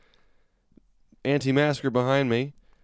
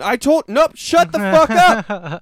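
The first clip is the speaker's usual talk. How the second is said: much higher and louder, slow dragged yell; louder shout; 11 semitones higher, 6 dB louder, slower than usual. Retold or imitated. Shutting the heckler up, slow loud yell. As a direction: bellow each word slowly. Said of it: "I just kept getting louder and louder".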